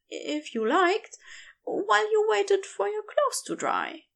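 the centaur fussing hospitably, shy and keen; lightly voiced Aziraphale. Aziraphale voiced, higher than narration.

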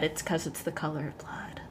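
mock-creepy whisper. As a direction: hush it like a creepy secret. Spooky punchline about the cult.

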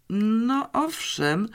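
slow, high, drawn-out admission; hesitant yes; slightly reluctant. A narrator's reading. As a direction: admit it grudgingly, stretching the words. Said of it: "przyznała Janeczka z lekkim oporem".

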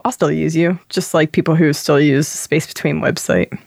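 normal talking voice

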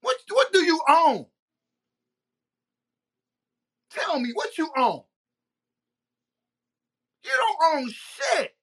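high, heated, incredulous rant; real exasperated yelling; angry challenge. Real, not acted.

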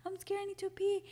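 high-pitched imitation of a frightened sister; scared sister. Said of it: "and she was so scared".

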